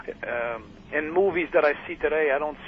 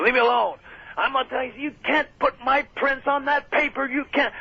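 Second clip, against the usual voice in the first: retold screaming; 10 semitones higher than usual. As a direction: shout him off, pitched well up.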